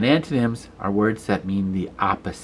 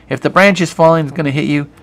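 urgent; 6 semitones higher, 6 dB louder, faster than usual. Real, not acted. Teacher stresses the danger.

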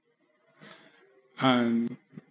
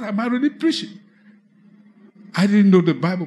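usual speaking voice

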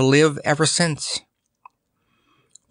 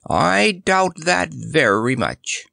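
slow, higher-pitched drawn-out doubt; doubtful dromedary; 6 semitones higher, slower than usual. A narrator's reading. Dromedary doubles down on his objection, doubtful. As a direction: stretch it out, insisting on the doubt.

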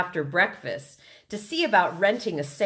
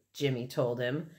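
plain reading voice

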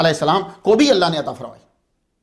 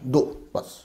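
ordinary talk, nothing marked

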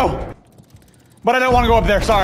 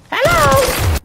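mock sing-song hello. Greeting enemy in mock style.

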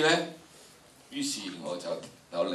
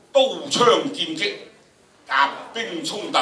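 loud, slow, higher, words struck hard; loud battle recital; 7 semitones higher, 8 dB louder than usual. Shown as a demonstration. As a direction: declaim it like clashing armies. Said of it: "大概去到七度到啦".

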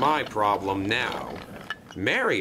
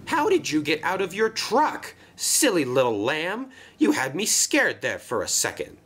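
relieved Marion scolding; 7 semitones higher than usual. Acted.

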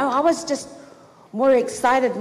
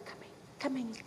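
much softer, giggling; hushed laughing; 17 dB quieter, faster than usual. Retold or imitated. Girl creeping up on her secret friend, hushed and giggly. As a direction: sneak it under your breath. Sneaking up to Alan.

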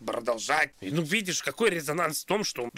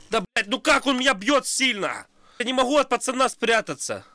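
loud frustrated yelling; raging gamer; 10 semitones higher, 7 dB louder than usual. Real, not acted. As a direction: yell in real gamer rage. Frustrated gamer losing fight.

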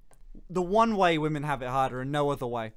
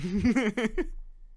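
higher-pitched burst of laughter; amused laugh; 7 semitones higher than usual. Real, not acted. Amused reaction to co-host's remark.

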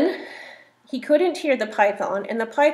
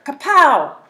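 loud slap effect; 5 semitones higher, 11 dB louder than usual. Acted.

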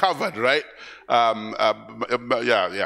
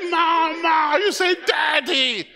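put-on baby's first words; 11 semitones higher, slower than usual. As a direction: call out like a toddler learning words.

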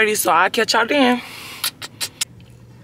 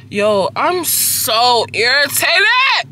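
higher, slow exasperated whine; drawn-out irritation; 5 semitones higher, much slower than usual. Acted. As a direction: stretch each word out, fed up. Fed up before her appointment.

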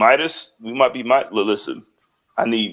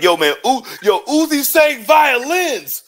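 high, slow, drawn-out mocking tweet voice; [name] impression; 12 semitones higher, slower than usual. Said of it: "be like".

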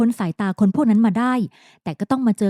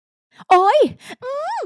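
high, sudden "โอ๊ย" cry; startled yelp; 3 dB louder, much slower than usual. A narrator's reading. Woman tossed onto the sofa, sharp startled yelp. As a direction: yelp as she's thrown down. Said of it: "เธออุทานเสียงหลง".

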